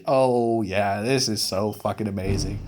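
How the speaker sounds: mock enthusiasm, deadpan and low; sarcastic. Vlogger mocking the next movie, sarcastic. Sarcastic about episode nine.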